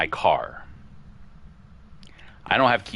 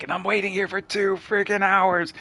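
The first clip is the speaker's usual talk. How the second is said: high, strained yell of frustration; retold frustrated yell; 13 semitones higher than usual. Stranded outside, yelling her story into the phone, worked up and high-pitched. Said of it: "I'm yelling into my phone".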